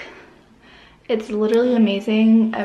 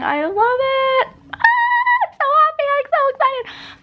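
squealing with joy. Real, not acted. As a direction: squeal it, over the moon. Thrilled with her makeup.

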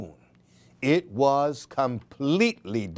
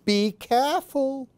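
higher motherly caution; gentle warning; 10 semitones higher than usual. Acted. Mother Hen warning Baby Chick gently. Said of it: "said Mother Hen".

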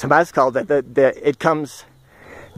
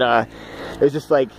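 ordinary talk

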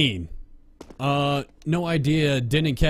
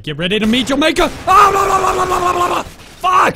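rising yell into rapid babbling roar; hammed-up high, loud war cry; battle-cry shout; 10 dB louder than usual. Battle cry while firing in game.

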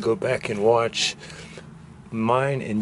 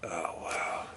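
awed whisper; 5 semitones lower, 9 dB quieter than usual. Real, not acted. Awed at a find in the basement.

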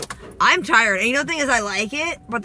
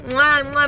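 loud kissing sounds, a bit high; between kisses; 4 semitones higher, 5 dB louder than usual. Real, not acted. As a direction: blow two kisses at the camera. Blowing kisses goodbye.

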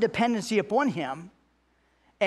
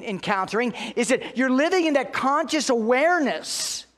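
louder, higher pulpit preaching; full-force preaching; 5 semitones higher, 3 dB louder than usual. Acted. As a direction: preach it hard to the congregation.

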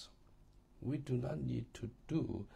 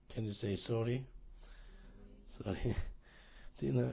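normal talking voice

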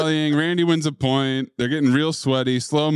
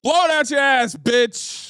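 trash talk; 10 semitones higher than usual. Acted. Trash talk before the match.

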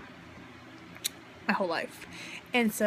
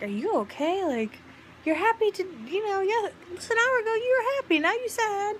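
high, fast mock husband voice; imitating husband; 11 semitones higher than usual. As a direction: mimic the husband's puzzled question, pitched up high. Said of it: "My husband's like".

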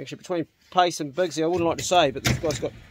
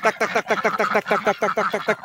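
quick higher duck call chant; 6 semitones higher, 3 dB louder than usual. Real, not acted. Farmer calling his ducks to the feeder, quick higher chant. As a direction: rattle off a call to bring the ducks in.

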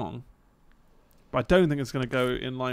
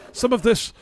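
loud, higher cranky-elder rant; hammed-up old man scolding kids' music; mock old man grumbling; 8 semitones higher, 9 dB louder than usual. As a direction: scoff like a disapproving grandpa.

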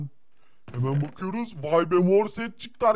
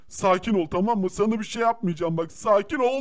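usual speaking voice

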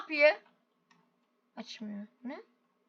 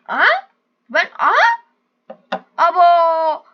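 excited exclamations; 2 semitones higher, 9 dB louder, slower than usual. Real, not acted. Gamer yelping at the goat's stunt, excited. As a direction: yelp at what he just found.

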